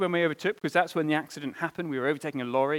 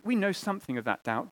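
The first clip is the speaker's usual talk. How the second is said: usual speaking voice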